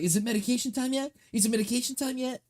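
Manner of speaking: high, fast impatient pestering; impatient eager. Retold or imitated. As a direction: pester like a kid who can't wait. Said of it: "Be looking at my watch like".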